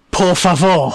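hammed-up Spanish ending, laughing; mock Spanish tag. Co-host mocks tourist's Spanish, laughing. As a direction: tack on a clumsy Spanish word. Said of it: "stick a Spanish word at the end".

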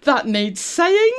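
high, loud rising disbelief; incredulous reaction. Retold or imitated. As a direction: stretch it into an appalled question. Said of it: "I'm like".

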